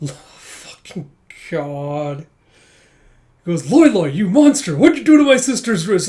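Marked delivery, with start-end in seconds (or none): 3.7-6.1 high, loud comic fury; outraged accusation. Retold or imitated. Brother blames husband for sister's wrist, outraged yelling. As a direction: hurl the accusation, hammed-up and loud.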